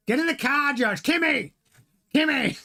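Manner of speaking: high-pitched barked call, badly put-on accent; Toto. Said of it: "I've got a terrible Toto impression".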